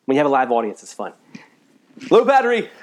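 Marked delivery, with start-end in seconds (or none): 2.1-2.7 high, loud mock alarm voice; mock battery alert. Preacher joking to live audience about his phone, mock alert. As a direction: call it out like a jokey phone alert.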